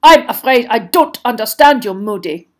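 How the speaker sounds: loud, stiff dismissal; haughty, dropping off on the name; trying to sound disdainful. A narrator's reading. Headmaster brushing off Moody, disdainfully. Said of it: "he was trying to sound disdainful".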